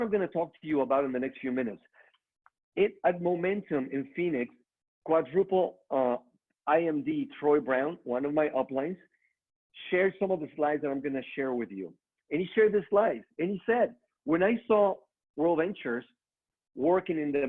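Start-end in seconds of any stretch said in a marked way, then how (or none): none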